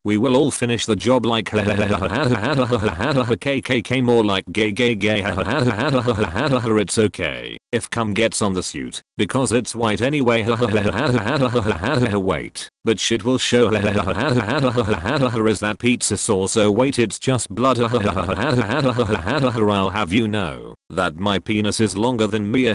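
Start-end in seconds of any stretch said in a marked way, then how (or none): 1.5-3.3 breathy mock villain cackle; hammed-up wicked laugh; evil cackle. Cackling while finishing the job. 5.3-6.8 breathy mock villain cackle; hammed-up wicked laugh; evil cackle. Cackling after his own joke. 10.5-12.1 breathy mock villain cackle; hammed-up wicked laugh; evil cackle. Cackling after his own joke. 17.8-19.7 breathy mock villain cackle; hammed-up wicked laugh; evil cackle. Cackling after his own joke.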